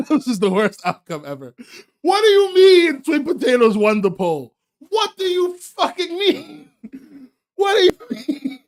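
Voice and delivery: loud, high, half-laughing disbelief; mock outrage. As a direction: scream your disbelief at chat, laughing through it. Streamer loses poll, mock outrage.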